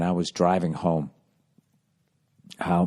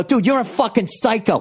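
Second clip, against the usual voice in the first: high, heated road-rage accusation; re-enacted high-pitched shout; yelling; 13 semitones higher than usual. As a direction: yell it at the other driver, pitch up high. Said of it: "I'm yelling at the guy".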